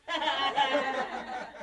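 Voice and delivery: high, breathless burst of laughter; helpless laugh. Real, not acted. Telling the tortoise bit, a high burst of laughter. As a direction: crack up at your own story.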